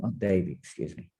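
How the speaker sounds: ordinary talk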